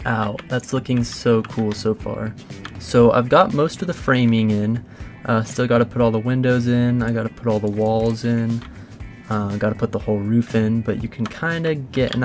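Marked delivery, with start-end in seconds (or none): none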